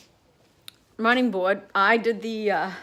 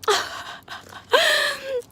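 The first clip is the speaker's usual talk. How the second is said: laughing with joy, high; high delighted laugh. Real, not acted. Widow seeing her repaired light work, high joyful laugh.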